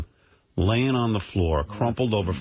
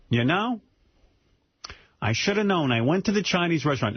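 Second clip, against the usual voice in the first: higher, a lightly voiced worried mother; mother's voice; 7 semitones higher than usual. Daughter voicing her mother after the fall, lightly higher. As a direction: play the mother, a bit higher. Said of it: "my mother says to me".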